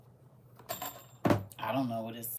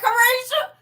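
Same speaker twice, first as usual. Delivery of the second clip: Carisha cried out high and loud with a rising end; loud teasing call of Carisha; yelled name; 9 dB louder than usual. Shown as a demonstration. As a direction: holler Carisha like a cousin across the yard. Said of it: "I be like".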